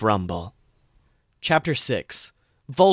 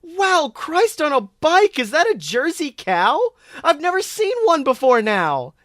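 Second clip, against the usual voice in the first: much higher, loud gushing; Susan exclaiming; 14 semitones higher, 3 dB louder than usual. A narrator's reading. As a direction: squeal with delight at the cow. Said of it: "Susan exclaimed ... at full volume".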